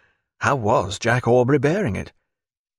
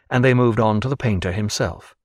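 ordinary delivery

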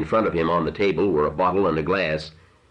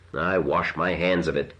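usual speaking voice

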